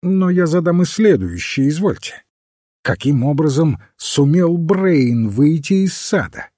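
mildly exasperated compliance; irritated. A narrator's reading. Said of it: "раздражённо заметил доктор".

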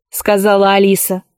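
plain reading voice

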